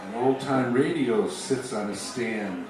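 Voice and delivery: normal talking voice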